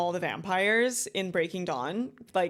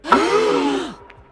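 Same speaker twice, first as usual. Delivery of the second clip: loud, high sharp gasp of shock; 13 semitones higher, 11 dB louder than usual. Real, not acted. Reviewer stunned by the witch reveal, loud gasp. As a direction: gasp as the twist lands. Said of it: "Y'all, I was gagged".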